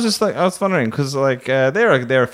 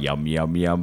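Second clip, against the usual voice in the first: low yum noise; 8 semitones lower, 4 dB quieter, slower than usual. Retold or imitated. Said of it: "I'm still like".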